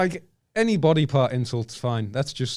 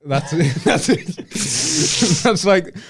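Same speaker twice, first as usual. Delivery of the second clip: louder, laughing through the words; giggling it out, higher; 6 semitones higher, 7 dB louder, slower than usual. Real, not acted. Laughing at park name.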